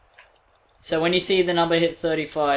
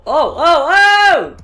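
"oh" cries climbing high and loud; rising excited cries; 9 semitones higher, 6 dB louder, much slower than usual. Real, not acted. Player watching the throw fly, rising cries. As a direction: build to a high, loud cry.

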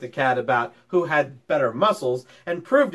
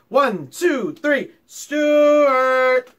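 counting up into a loud, higher call; 7 semitones higher, 6 dB louder, much slower than usual. Acted. Mister Little leading the family call for Stuart, rising loud call. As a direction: build to a loud call of the name.

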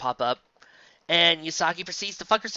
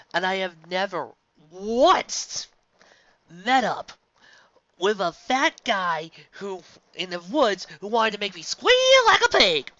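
rising rant; 4 semitones higher, 6 dB louder than usual. Real, not acted. Ranting about Deliverance cliche.

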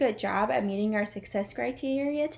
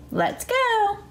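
excited cheer; 11 semitones higher than usual. Acted. Cheering kids on.

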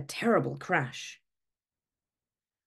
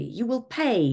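higher, a mock outburst; hammed-up threat from the sun; angry declaration; 7 semitones higher, 3 dB louder than usual. A narrator's reading. The sun warns the moon, mock eruption. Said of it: "He erupted intensely".

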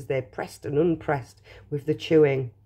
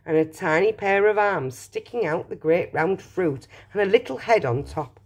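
ordinary delivery

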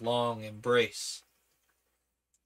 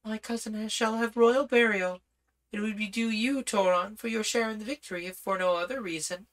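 princess voice; 8 semitones higher than usual. Acted. Princess speaks to Toron.

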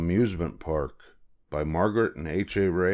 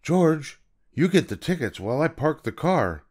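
higher, friendly instruction; man with the yellow hat; 8 semitones higher than usual. Acted. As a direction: call to the monkey, then explain. Man gives George a task.

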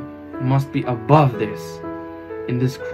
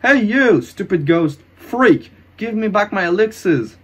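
raised, furious yelling; angry demand; 6 semitones higher, 5 dB louder than usual. Acted. Hero demanding elixirs from the ghost, angry. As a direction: lash out at the thief.

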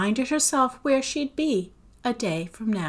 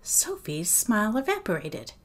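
ordinary delivery

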